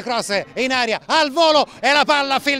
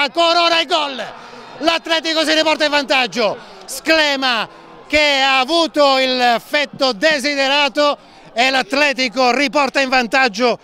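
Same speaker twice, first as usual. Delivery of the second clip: breathless italian goal commentary. Real, not acted. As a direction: call the goal with a shout. Goal called live.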